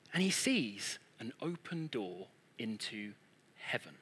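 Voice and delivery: low, soft, halting reveal; hushed awe. Retold or imitated. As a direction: let the voice drop into hushed wonder.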